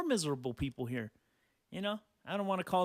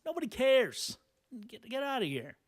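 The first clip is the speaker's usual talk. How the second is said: exasperated; 7 semitones higher than usual. Real, not acted. Exasperated dismissal.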